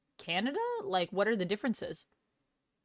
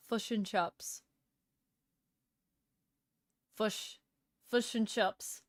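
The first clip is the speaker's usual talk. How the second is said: repeating fush and chups in kiwi vowels; New Zealand accent; 2 semitones higher, 3 dB quieter, slower than usual. Shown as a demonstration. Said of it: "For New Zealand".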